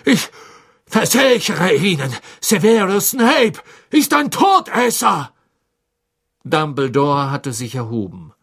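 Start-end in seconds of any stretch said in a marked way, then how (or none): 0.0-5.3 desperate shouting. A narrator's reading. Chained prisoner desperately accusing.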